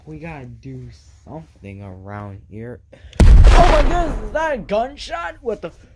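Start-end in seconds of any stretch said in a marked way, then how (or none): 3.2-5.9 high, loud frightened shouting; frantic, pitched-up panic; panicked. Acted. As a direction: cry out in sudden alarm. Gunshot heard, panic.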